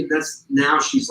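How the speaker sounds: ordinary talk, nothing marked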